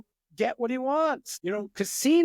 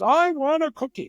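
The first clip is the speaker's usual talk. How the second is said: put-on higher, louder repeated kid demand; mock child demanding; 8 semitones higher, 5 dB louder than usual. Timmy nagging mom for a cookie, louder demand. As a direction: push the request like a stubborn child. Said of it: "Third time".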